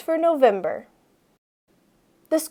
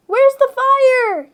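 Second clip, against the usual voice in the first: high, slow teasing yell; a boy yelling after a running girl; 12 semitones higher, 4 dB louder, slower than usual. Acted. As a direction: call it out like a joke alarm. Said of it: "yelled Sam".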